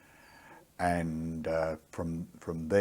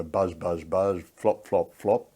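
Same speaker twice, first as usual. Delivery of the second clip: louder, rhythmic mock rotor chop; helicopter noise; 7 dB louder, slower than usual. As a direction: imitate a helicopter landing, buzz and flop.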